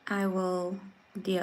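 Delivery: normal talking voice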